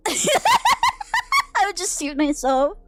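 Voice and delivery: words stretched slowly through high laughter; laughing. Real, not acted. Cracking up at the gross note, laughing through it. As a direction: laugh the line out, high and drawn.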